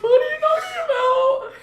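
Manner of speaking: high loud exasperated cry; baffled shout. Retold or imitated. Friends baffled by an accusation, loud high disbelief. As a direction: throw it back loud and disbelieving.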